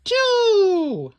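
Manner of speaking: very high, loud call-out; excited answer. A narrator's reading. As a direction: shout the answer to the kids. Counting buttons left.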